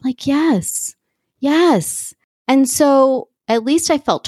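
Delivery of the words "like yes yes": excited recognition. Retold or imitated. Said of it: "I was just like".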